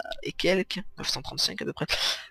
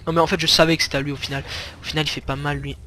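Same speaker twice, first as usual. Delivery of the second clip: ordinary talk, nothing marked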